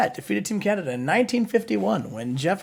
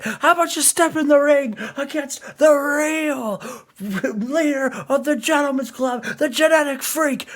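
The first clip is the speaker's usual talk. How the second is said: Scott Steiner impression; 4 semitones higher than usual. Co-host voicing Scott Steiner insulting Jim Cornette, raised shouting rant. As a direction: yell it like a cocky heel trash-talking. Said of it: "He says".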